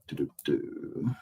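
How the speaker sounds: ordinary talk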